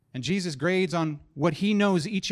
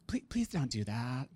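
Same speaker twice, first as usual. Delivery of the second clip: soft, slow begging voice; mock pleading counselor; 3 semitones lower, 7 dB quieter than usual. Counselor begging the preacher not to do voices, soft plea. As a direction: plead gently, please don't. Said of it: "Is it okay if I use my voices here?".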